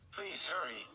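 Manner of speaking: high, pressing plea; urging. A narrator's reading. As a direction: hurry her along, pleading. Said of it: "he urged".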